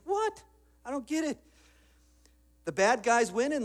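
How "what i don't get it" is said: high-pitched mock bewilderment; hammed-up, much higher incredulous question; baffled disbelief. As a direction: squeak it out, comically confused. Voices the baffled good guy.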